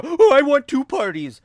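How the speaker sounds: loud, high spoiled whine; whiny relative. Retold or imitated. Mocking a relative who wants two parties, loud shrill whine. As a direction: ham up a greedy child's demand. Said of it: "Have a baby shower".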